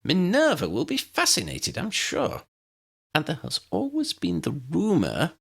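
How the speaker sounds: put-on high, admiring old wizard voice; small wizard, impressed; eager little professor. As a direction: pitch it up and marvel. Flitwick voice continues.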